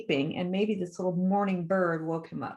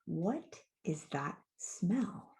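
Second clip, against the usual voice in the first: slow, hushed, whispery question; soft, eerie drawn-out voice; mysterious cave creature; 8 dB quieter, much slower than usual. A narrator's reading. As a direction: whisper it slowly from the dark. Unseen thing speaks from the cave.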